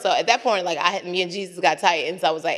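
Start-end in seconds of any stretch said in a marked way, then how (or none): none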